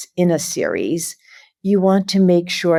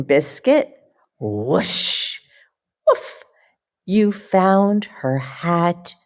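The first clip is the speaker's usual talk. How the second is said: lively, slow picture-book reading with puppy noises; reading to children; 3 semitones higher, slower than usual. Acted. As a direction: read it like bedtime story time for little kids.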